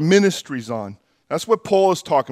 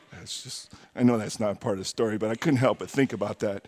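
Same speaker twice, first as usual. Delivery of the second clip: dropped low and soft, hurried; quiet aside; 7 semitones lower, 11 dB quieter, faster than usual. Real, not acted. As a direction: mutter the aside quickly. Aside about the story.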